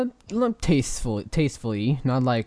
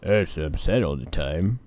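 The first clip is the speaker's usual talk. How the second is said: low husky voice; sad, deep and gravelly; husky fish voice; 7 semitones lower than usual. Acted. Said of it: "It's got a very husky voice".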